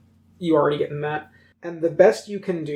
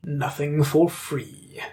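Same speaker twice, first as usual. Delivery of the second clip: hollow talking mask; 2 semitones lower, 3 dB quieter, much slower than usual. Acted. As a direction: speak as an eerie floating mask. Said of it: "Do the mask voice".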